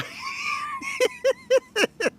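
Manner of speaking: high laughter dropping the act; can't hold the villain act, laughs; laughter ending the prank; breaking into laughter. Real, not acted. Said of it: "You're laughing?".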